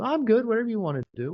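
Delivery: high, breezy deferring; lightly voiced pushover; mock nice guy. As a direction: shrug it off sweetly.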